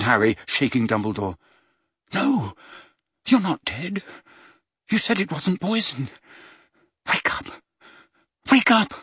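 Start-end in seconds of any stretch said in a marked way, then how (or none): 2.1-8.9 desperate pleading. A narrator's reading. Harry pleading.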